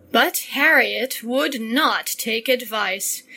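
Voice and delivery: plain reading voice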